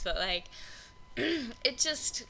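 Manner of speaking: usual speaking voice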